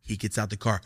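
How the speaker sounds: ordinary talk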